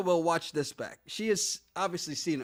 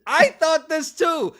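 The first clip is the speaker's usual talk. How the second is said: loud, high, slow exclamation; excited agreement; 9 semitones higher, 9 dB louder, slower than usual. Real, not acted. Co-host agreeing with a viewer's theory, loud excitement. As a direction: belt it out with each word stretched.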